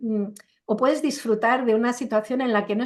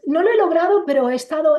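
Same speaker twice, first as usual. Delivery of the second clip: higher, louder whiny mock excuse; hammed-up higher voice of a quitter; 5 semitones higher, 5 dB louder than usual. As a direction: parody someone excusing their failure. Mocking someone's excuse for failing.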